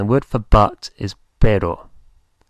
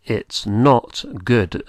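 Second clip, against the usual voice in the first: slow dictation; much slower than usual. Shown as a demonstration. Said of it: "And how about".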